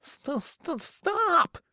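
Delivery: high, frightened stammer. A narrator's reading. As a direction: stammer in panic. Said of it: "stammered Richard".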